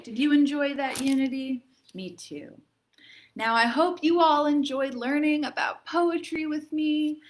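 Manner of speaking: ordinary delivery, nothing marked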